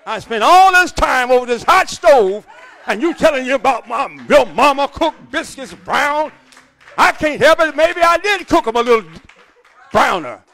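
loud, high indignant wife, mock hurt; hammed-up scold over the hot stove; upset wife. Wife scolding husband over his mother's biscuits, mock indignant yell.

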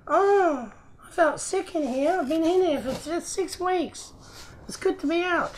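very high little creature, mock-plaintive; toy koala voice. Koala toy fresh from six weeks boxed, high put-on voice. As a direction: squeak out a small toy's complaint.

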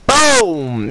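very high, loud victory yell; triumphant shout. Real, not acted. Celebrating a kill, shouted take.

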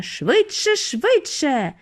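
high, insistent repeated call; pressing, excited cries; urgent cry. A narrator's reading. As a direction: urge them on, pitched high. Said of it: "кричав містер Вонка".

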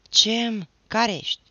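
usual speaking voice

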